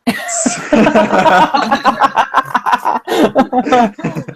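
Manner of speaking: high, long burst of real laughter. Real, not acted. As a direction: crack up hard. Laughing at own insult.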